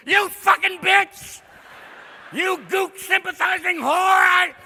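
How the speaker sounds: high, loud, enraged screaming; furious scream. Acted. McCain screaming at Jane Fonda, high loud furious yell. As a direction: scream it at her, losing all control.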